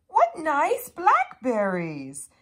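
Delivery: high, loud little bird voice; chirping sparrow. A narrator's reading. Sparrow asks Mouse for berries, chirping high. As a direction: chirp it out high and bright-eyed. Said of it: "chirped Sparrow".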